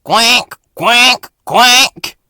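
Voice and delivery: loud, high duck quacks; mocking quacks. A narrator's reading. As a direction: quack loudly to tease. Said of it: "and they wheeshed".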